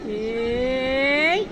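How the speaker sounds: ordinary talk, nothing marked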